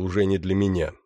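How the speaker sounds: ordinary delivery, nothing marked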